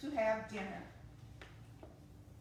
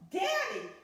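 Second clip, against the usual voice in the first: put-on high, loud child's call; little girl yelling for her dad; 10 semitones higher, 5 dB louder than usual. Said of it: "yelled the little girl".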